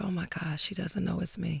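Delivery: very quiet, retold aside of dismay; hushed realisation. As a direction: drop the voice right down, as if to yourself. Retells painful realisation about her mother.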